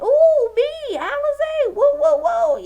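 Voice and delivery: high, loud eager calls to be picked; begging the teacher to be picked, squealing; eager hand-raising. Retold or imitated. Student begging teacher to pick her, eager squeal. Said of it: "I'll just be raising my hand".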